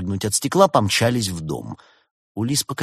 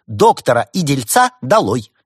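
loud, raised chant of protest; demagogue's shout; rallying cry; 5 semitones higher, 8 dB louder than usual. A narrator's reading. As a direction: call for their expulsion. Actress rallies against both.

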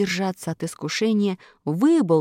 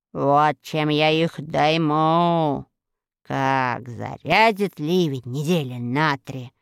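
gloating witch. A narrator's reading. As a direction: savor the flood plan. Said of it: "приказала сама себе Арахна".

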